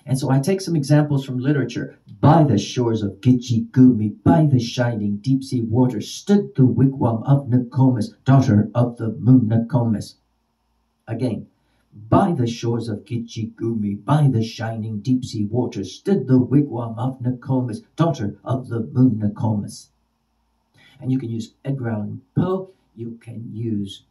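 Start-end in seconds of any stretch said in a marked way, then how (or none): 2.2-10.1 loud, metrical recitation, every stressed syllable pounded; staccato verse rhythm. Shown as a demonstration. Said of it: "the staccato sounds". 12.1-19.9 chant-like beat on "By the shores of Gitche Gumee"; steady metrical recitation, stresses marked; staccato verse rhythm. Shown as a demonstration. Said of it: "the staccato sounds".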